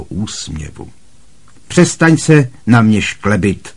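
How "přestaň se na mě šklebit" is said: loud, higher thunder; thundering command. A narrator's reading. Aunt Marge ordering Harry to stop grinning, loud thunder. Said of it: "zahřměla teta March".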